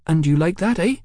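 high-voiced cheeky London girl. A narrator's reading. Hetty questions Mallory.

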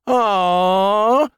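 long, high "aww"; drawn-out whine of disappointment; disappointed wail. A narrator's reading. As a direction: wail like children who lost out. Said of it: "the others wailed".

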